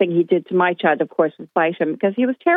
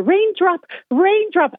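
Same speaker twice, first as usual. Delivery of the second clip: high sing-song call of a pet name; mock owner calling dog; 12 semitones higher, slower than usual. Caller imitating a dog owner chasing her loose dog, high sing-song. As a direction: mimic a posh owner calling their dog sweetly.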